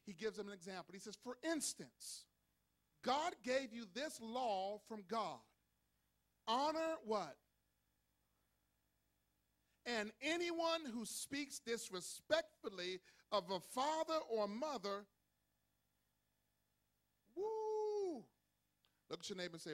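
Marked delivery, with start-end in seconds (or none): 1.2-15.1 loud, slow preacher's reading; emphatic scripture declamation. Acted. 17.3-18.3 high, long whoop; loud preacher's "woo". Real, not acted. Preacher reacts to stern verse.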